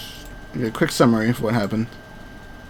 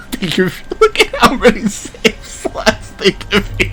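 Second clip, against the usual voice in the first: laughing; 10 semitones higher, 3 dB louder, slower than usual. Real, not acted. Laughing at the many save slots.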